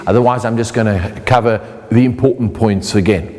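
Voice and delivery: ordinary talk, nothing marked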